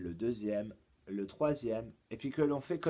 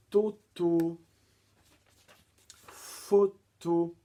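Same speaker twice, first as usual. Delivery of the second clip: toto and photo high, loud and split in two; syllable by syllable; 6 semitones higher, 8 dB louder, much slower than usual. Shown as a demonstration. Teacher lists pupils' rhymes, toto and photo split by syllable.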